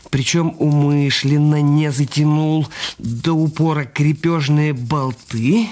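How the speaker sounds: ordinary talk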